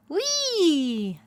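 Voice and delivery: high, loud "Whee!" of joy; a little owl's happy yell; gleeful yell. A narrator's reading. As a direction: whoop it out as you take off. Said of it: "Spotty yelled".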